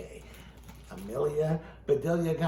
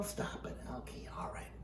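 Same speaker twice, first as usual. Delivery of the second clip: hushed aside; 6 semitones lower, 15 dB quieter than usual. Real, not acted. Dummy and host bicker aside.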